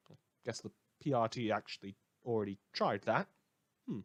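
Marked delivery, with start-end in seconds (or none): none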